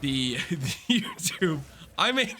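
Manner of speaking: laughing while talking. Real, not acted. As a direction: talk through a laugh and a cough. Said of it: "I'm coughing and laughing at the same time".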